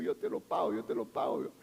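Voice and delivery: hammed-up desperate promise to pay; begging debtor. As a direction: beg him, almost out of voice.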